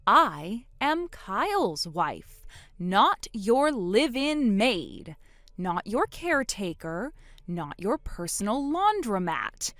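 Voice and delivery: slow, pointed word-by-word correction; drawn-out, emphatic list of refusals; slow, deliberate. Acted. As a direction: spell it out slowly for someone obtuse. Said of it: "let me say it slowly this time".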